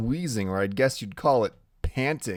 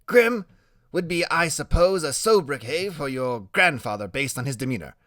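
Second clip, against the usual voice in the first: higher, drawn Graham; boy genius voice; 3 semitones higher than usual. Acted. Boy genius reasons out names to friend, higher. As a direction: deduce it like a little detective.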